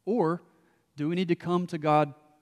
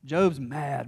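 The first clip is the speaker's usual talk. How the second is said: ordinary talk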